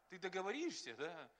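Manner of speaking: usual speaking voice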